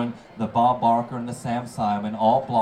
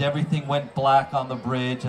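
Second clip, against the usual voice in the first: ordinary talk, nothing marked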